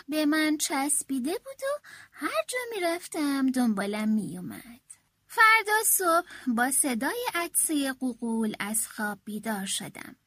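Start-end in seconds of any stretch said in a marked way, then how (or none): none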